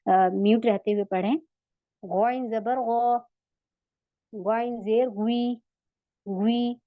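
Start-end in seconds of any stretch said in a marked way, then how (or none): 2.0-6.8 slow recital, गौ and गुई with throaty gh; heavy ghain drill. Shown as a demonstration. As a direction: draw out गौ and गुई, back of tongue raised. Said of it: "वैसे ही उठाने की कोशिश करें".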